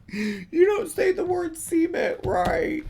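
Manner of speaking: slow, very high complaining voice; mock scolding. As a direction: imitate the hecklers in a high, drawn-out voice. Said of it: "gonna yell at me and be like".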